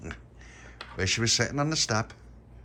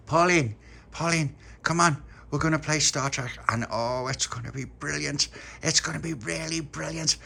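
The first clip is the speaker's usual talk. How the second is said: excited call; 7 semitones higher, 5 dB louder than usual. A narrator's reading. Puppy excitedly calls Pauline.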